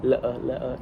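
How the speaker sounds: low, soft Cockney "Le-uh"; Cockney glottal stop for the t in letter; Cockney warm-up. Shown as a demonstration. Actor demonstrating his warm-up word, Cockney. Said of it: "attempting to say is letter".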